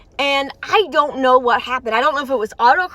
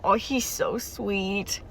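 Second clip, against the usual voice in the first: tender coo; 5 semitones lower, 10 dB quieter, much slower than usual. Real, not acted. Cooing over a character.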